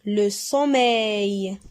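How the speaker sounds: Le sommeil said slowly and clearly; slow model word. Shown as a demonstration. Teacher models the word for the class, slowly. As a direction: stretch out Le sommeil for pupils. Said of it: "teacher models word".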